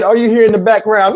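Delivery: ordinary delivery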